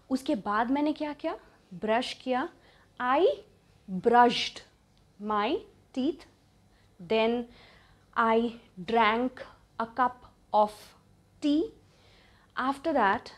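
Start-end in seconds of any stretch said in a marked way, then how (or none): 3.0-11.7 slow, with long pauses between phrases; feed the sentence to learners piece by piece; deliberate, spaced-out teaching model; word-by-word dictation. Shown as a demonstration.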